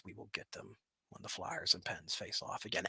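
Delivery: playful villain whisper; mock threat whispered. Teasing Philadelphia fans before Flyers and Pens meet, whispered. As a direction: whisper the hockey threat like a joke plot. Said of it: "I'm just kidding".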